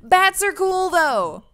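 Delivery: put-on high, loud teenage yell; yelling back. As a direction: call the answer back across a distance. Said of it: "[name] yells back".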